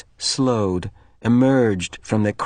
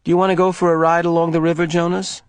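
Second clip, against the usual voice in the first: fast, higher nervous ask; girl nervously suggesting a ride; nervous question; 7 semitones higher, 3 dB louder, faster than usual. Acted. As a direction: hurry to change the subject. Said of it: "with nervousness".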